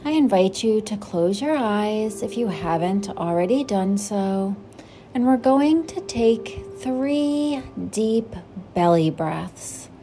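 gentle, unhurried meditation guide voice; guided meditation. Acted. Host guiding listeners into breathing, calm meditation voice. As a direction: settle the listener into stillness.

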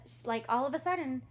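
ordinary talk

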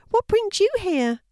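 lightly voiced kindly old neighbour; elderly lady voice. A narrator's reading. Missus Berry greeting Fiona at her gate, high old lady voice.

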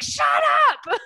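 mock-annoyed yell; exasperated shout. Friend fed up with random singing, mock exasperated shout. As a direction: loudly shut down a singing friend.

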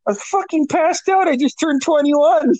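a hungover sister defending herself; sister's voice. Retold or imitated.

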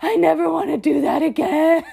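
high, loud exhausted outburst; loud weary groan of never again; exasperated cry. Retold or imitated. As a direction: throw it to the ceiling in relief. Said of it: "threw my head back and was like".

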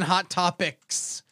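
slow, drawn-out announcer call; segment title. Acted. Host offering segment choices, slow announcer call. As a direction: stretch out the title like a game show.

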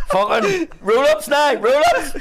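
high, loud, slow monkey moan; hammed-up grumpy smoking monkey; mock monkey complaining. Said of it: "he's like".

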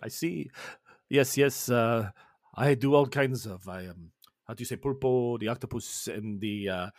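a cheerful chef introducing himself with a Spanish lilt; Spanish chef Edmundo. Acted. Edmundo the chef greets the crew, light Spanish accent.

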